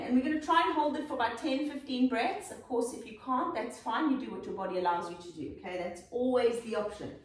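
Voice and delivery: ordinary talk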